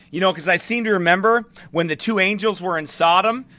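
usual speaking voice

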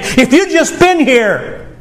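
high, slow, aching reproach; mild grieving reproach. Retold or imitated. Pastor voicing Martha to Jesus, slow aching reproach. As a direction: let the hurt hang on each word. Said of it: "for saying".

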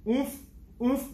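short punchy oomphs, climbing in pitch; forceful oomph. Shown as a demonstration. Preacher urging people to assert themselves, forceful. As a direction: oomph fired off in high little bursts. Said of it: "assert a little bit of".

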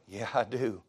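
a gentle, simple yes; quiet mock answer. Believer answering whether they love him, softly. Person answering the preacher's questions.

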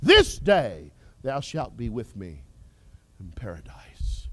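hushed Christ's promise. Acted. Christ's promise, fading to whisper.

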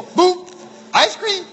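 high, perked-up excitement; sudden high-pitched eager reaction; imitated delight. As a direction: act out a wife perking up at ice cream. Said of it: "her eyes go".

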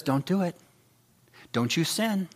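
God quietly warning the listener against sin; soft warning. Acted. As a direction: warn gently but firmly.

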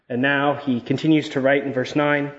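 ordinary talk

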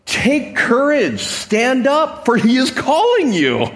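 the crowd telling the blind man to rise; encouraging crowd. Acted. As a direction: urge him up, slowly. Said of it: "the crowd says".

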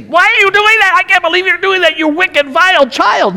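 mock-furious parent yelling at a child; mock angry scolding. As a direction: play the upset parent scolding a toddler, high and loud. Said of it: "should we get upset and say".

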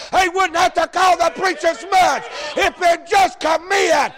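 loud, high shouted preaching, slowing down on key lines; roaring revival preacher driving it home; preaching at full force. Real, not acted. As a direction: build to a full-throated sermon climax. Preacher builds to full shouted exhortation.

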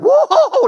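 high, loud mock stadium whoop; hammed-up football crowd cheer.

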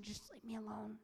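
cross words under the breath; mad, held in. Retold or imitated. Sister shuts out her sibling, quietly angry. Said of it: "She was so mad".

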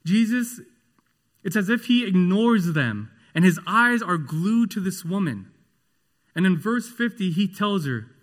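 loud, high, impassioned preaching; full-throated sermon delivery; forceful preaching. Acted. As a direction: preach it to the whole room.

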